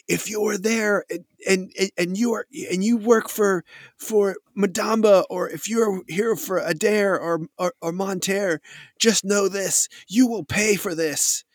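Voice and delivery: high-pitched defiant warning; threatening woman leader. Acted. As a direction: play a woman commander vowing revenge. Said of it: "you hear this woman say".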